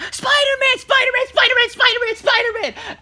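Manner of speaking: put-on high, loud rapid-fire kid chant; imitated excited kids. Young boys spotting their masked hero, excited shouting. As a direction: shout it over and over like thrilled kids. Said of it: "they're talking their heads off".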